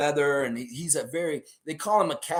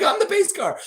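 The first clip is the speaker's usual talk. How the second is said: much higher and louder, through laughter; laughing high; 5 dB louder than usual. Retold or imitated. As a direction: crack up while declaring it. Driver repeats, laughing.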